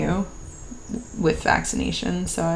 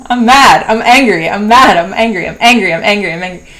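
loud, rapid-fire angry outburst, fading at the end; re-enacted anger; 3 semitones higher, 11 dB louder, faster than usual. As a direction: let the anger burst out in repeated punches. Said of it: "being mad".

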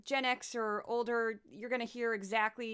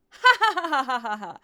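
sudden loud real laugh, higher; loud burst of laughter; 3 semitones higher, 12 dB louder than usual. Real, not acted. Host laughing at the Comey email memory, loud burst of laughter. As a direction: crack up out loud.

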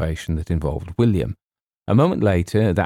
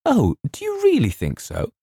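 put-on very high, delighted girlish question; light up with high-pitched delight; thrilled. A narrator's reading. Girl likened to a heroine, thrilled. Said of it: "Her face lit up".

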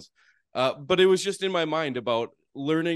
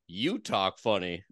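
slow, lower Texas drawl imitating the lady; Texas lady accent; 4 semitones lower, slower than usual. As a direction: mimic the Texan woman's slow drawl right back at him. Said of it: "And she's like".

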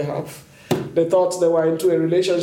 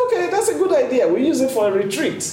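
eager agreement; 7 semitones higher, faster than usual. Retold or imitated. Retells her own eager reply.

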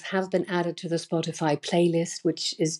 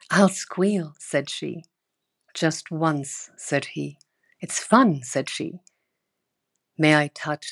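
playful call and answer poem reading; slower than usual. Acted. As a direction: read it like a private love poem. Reads E E Cummings poem.

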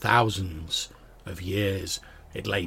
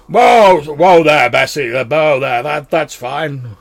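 loud calls to a horse, easing off; bellowing dwarf; 6 semitones higher, 15 dB louder, slower than usual. Acted. Dwarf steering his cart to a stop, bellowing at the horse. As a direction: holler the horse to a halt. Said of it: "he's like".

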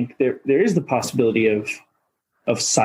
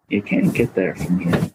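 New England accent; 7 semitones lower than usual. Shown as a demonstration. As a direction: say it low like an old Maine local. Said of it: "you gotta say it in the right accent".

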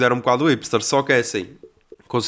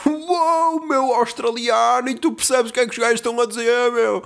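mock amazed dude; 10 semitones higher than usual. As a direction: squeal it out like the astonished guy, then rattle off the question. Mocking the guy's amazed reaction.

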